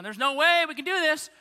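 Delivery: high, loud disbelief; exasperated outburst. Retold or imitated. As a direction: blurt it out like the numbers just failed. Philip with abacus, exasperated.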